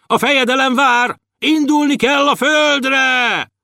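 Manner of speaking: high, urgent summons; guard shouting. A narrator's reading. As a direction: shout it high, drawing out the end. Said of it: "Kiabált a strázsa". (magasan, elnyújtva kiáltó strázsa)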